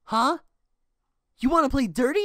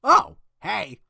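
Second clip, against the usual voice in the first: ordinary delivery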